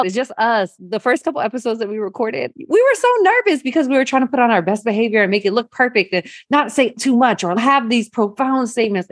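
ordinary talk

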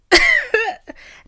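sudden high cackle; laughing at herself mid-story; high burst of laughter. Real, not acted. Laugh after bro.

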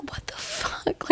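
slow, stunned whisper; whispered disbelief. Acted. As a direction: drop to a drawn-out, incredulous whisper. Acted disbelief at Dorinda's blunder.

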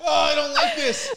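very high squeal through laughter; real giggly, high-pitched complaint; laughing protest. Real, not acted. Host objecting to the co-host's matching game, squealing with laughter.

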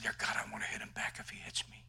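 hushed, breathy plea to God; whispered prayer. Retold or imitated. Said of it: "And I said".

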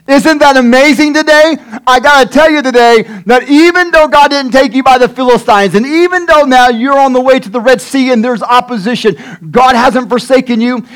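high, emphatic preacher's proclamation; impassioned preaching. Acted. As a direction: proclaim it with rising fervor. Preaches at full force.

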